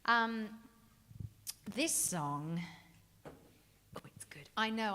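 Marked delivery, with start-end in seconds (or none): 3.3-4.5 whispered aside. Real, not acted. Whispered aside about songs.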